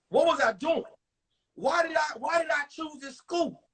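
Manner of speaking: normal talking voice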